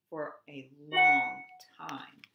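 slow narration with a big, held "long"; drawn-out emphasis. A narrator's reading. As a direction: draw out "long" to show the endless wait. Long wait acted in narration.

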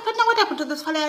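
high, fast shocked outburst. Retold or imitated. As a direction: gasp it out in disbelief. Auntie shocked at nephew's change.

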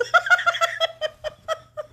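high, loud cackling giggle fit; long squealing laugh; helpless laughter. Real, not acted. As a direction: laugh uncontrollably at your own joke. Cracks up at own joke.

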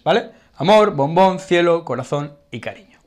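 normal talking voice

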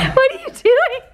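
high, slow, laughing disbelief; laughing question. Real, not acted. Wife watching husband pluck his unibrow, high laughing disbelief.

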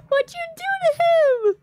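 very high, squeaky cartoon voice; squeaky mock outrage. Streamer reacting to a viewer's drawing of her, in a squeaky high voice. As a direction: squeal in fake shock at the drawing.

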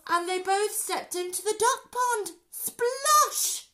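high, loud storytelling peaking on the splash; excited climax. A narrator's reading. Narrator telling children the robbers hit the pond, excited and loud. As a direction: build to a big high splosh.

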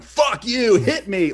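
high, loud mock rage; retold yelled challenge. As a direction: act out a ranting guy daring someone. Re-enacts his earlier yelling.

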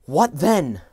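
put-on loud, sharp young man's comeback; retorting. A narrator's reading. Young Scrooge to the girl, retorting loudly. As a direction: snap it back defensively. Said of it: "he retorted".

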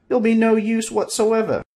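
high, loud dismissal; little man's voice. Acted. Little man dismissing Bob, high and loud.

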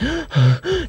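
a hammed-up, high panicked gasp; teammates' mock gasp before the finals. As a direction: gasp like the team is doomed. Team panics on the bus.

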